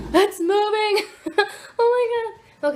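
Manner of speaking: high, loud shriek breaking into laughter; startled, high-pitched nervous laughing cry; panicked squeal. Real, not acted. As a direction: squeal in real alarm, then laugh it off. Wasp moves, she panics.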